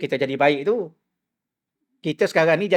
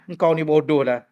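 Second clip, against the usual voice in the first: friend's insult. Retold or imitated. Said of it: "Kalau kawan kita kata bodoh".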